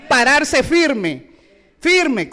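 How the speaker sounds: urging the church to stand firm; emphatic firme. Real, not acted. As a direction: drive home firme word by word. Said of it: "showing standing firm".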